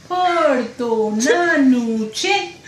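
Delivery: very slow, drawn-out grumble; father-in-law's remark. Retold or imitated. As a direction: drag it out like a fussy father-in-law. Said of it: "ખોલીને આમ કે".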